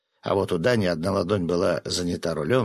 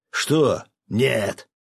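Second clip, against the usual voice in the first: raised, alarmed protest; higher, shocked outburst; startled refusal; 4 semitones higher, 4 dB louder than usual. A narrator's reading. Fisherman told he must shave, startled refusal.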